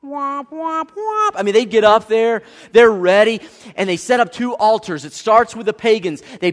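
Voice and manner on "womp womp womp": high whistled-up rise into sliding womps; imitated showdown sound. Preacher scoring the Mount Carmel showdown, playful vocal sound effect. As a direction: mimic a tense film-duel sting with the voice.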